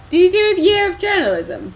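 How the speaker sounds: high, loud call across the room. Real, not acted. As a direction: shout the question over. Calling across to Stephen.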